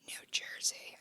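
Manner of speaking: whispered like a guilty secret; mock whisper. Admitting to the co-host where she was born, mock whisper. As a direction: drop into a playful whisper. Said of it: "I gotta whisper it".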